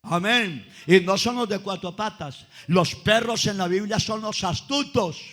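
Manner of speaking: lively storytelling preacher; preaching. Acted. Preacher telling the congregation about the Canaanite woman, preaching. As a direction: voice the disciples and Jesus with fire.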